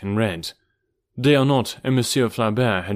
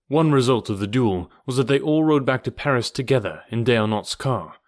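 usual speaking voice

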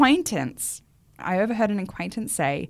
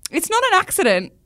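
louder, higher, incredulous no; exasperated; 7 semitones higher, 5 dB louder than usual. Retold or imitated. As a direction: insist it's NOT an accident. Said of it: "And I'm like".

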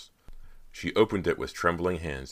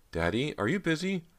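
hesitant question; 13 semitones higher than usual. A narrator's reading. Hesitant daughter interrupts her father.

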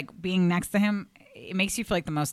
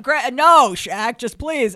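imitating Greg pleading; 7 semitones higher, 9 dB louder, much slower than usual. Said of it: "He'd be like".

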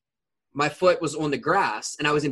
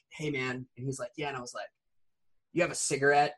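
very soft, offhand retold exchange; imitating a hushed, unbothered request; low-key casual ask; 3 semitones lower, 8 dB quieter, faster than usual. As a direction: drop the voice and ask nonchalantly. Retold casual ask while bleeding.

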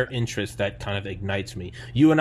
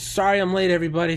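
comic's greeting; 8 semitones higher, 5 dB louder, faster than usual. Retold or imitated. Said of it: "He goes".

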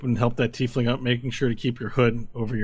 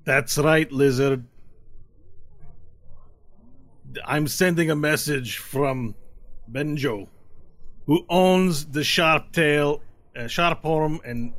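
loud, higher, slow threat; menacing lead tiefling; 4 semitones higher, 4 dB louder, slower than usual. Acted. Lead tiefling threatening the lizard bartender. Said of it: "he goes".

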